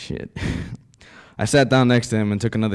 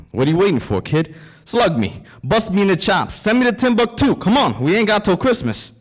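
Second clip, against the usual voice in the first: goading yell, getting louder; taunting shout; 11 semitones higher, 8 dB louder than usual. Acted. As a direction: dare him to shoot, yelling. Dying gangster taunting shooter.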